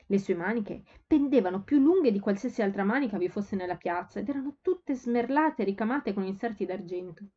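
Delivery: plain reading voice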